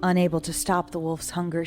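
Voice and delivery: ordinary delivery, nothing marked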